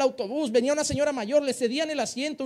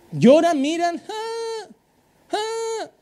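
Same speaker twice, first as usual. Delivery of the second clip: mock fake crying; 8 semitones higher, 3 dB louder, much slower than usual. Pastor mimicking a child's fake crying wails. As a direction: imitate a child's fake bawling.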